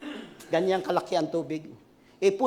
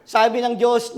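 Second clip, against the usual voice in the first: ordinary delivery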